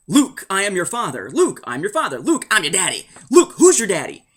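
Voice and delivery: hammed-up quotes, punching LUKE; mock pop-culture parody. Fan parodying a famous movie line, hammed-up mock voice. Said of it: "in pop culture, it's constantly".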